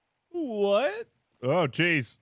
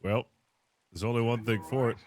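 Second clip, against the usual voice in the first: usual speaking voice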